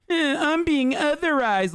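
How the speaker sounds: high, slow mocking whine; whiny baby voice. Mocking conservatives as whiny babies, in a high slow whine. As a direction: mimic a crybaby complaining, drawn out. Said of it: "whiny ass, goo goo gaga ass baby".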